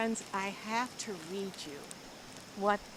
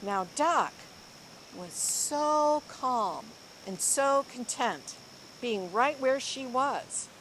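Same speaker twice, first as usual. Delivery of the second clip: lively, expressive storybook reading; reading to children; 3 semitones higher, 6 dB louder than usual. Acted. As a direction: read it like a picture book to a class. Teacher reads picture book aloud.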